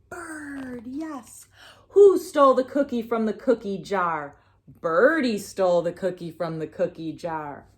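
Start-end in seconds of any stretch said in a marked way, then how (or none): none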